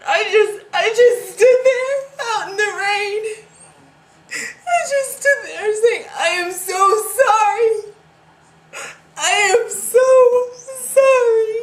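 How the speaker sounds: loud, high-pitched sobbing; wailing apology. Acted. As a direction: drag the words out through heavy crying. Breakdown, apologising to the dead man.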